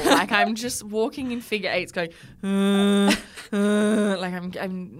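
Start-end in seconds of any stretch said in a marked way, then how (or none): 2.4-4.2 loud guttural moo-like groan; imitating a mooing distressed animal. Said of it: "mooing almost".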